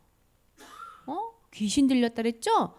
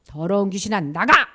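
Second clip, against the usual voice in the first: loud order building on the last word; acted command; 2 semitones lower, 7 dB louder than usual. Jesus ordering a demon out, loud command. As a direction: cast it out with a forceful push.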